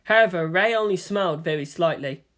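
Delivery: ordinary delivery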